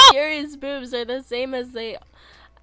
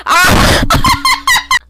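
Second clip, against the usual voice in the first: normal talking voice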